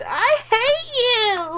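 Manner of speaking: high, loud, drawn-out mock yell; over-the-top imitation of a tormented scream. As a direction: wail it like a hammed-up advert. Mock re-enactment of advert's screams.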